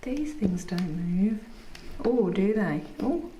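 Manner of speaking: slow unvoiced whisper. Real, not acted. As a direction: murmur to herself. Whisper in bath.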